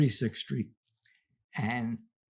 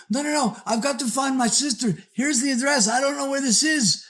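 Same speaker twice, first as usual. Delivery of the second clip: higher, fast, panicky woman's voice; pleading, rushed, near tears; frantic woman; 8 semitones higher, faster than usual. Retold or imitated. Frantic woman pleading with strangers on the street, higher and rushed.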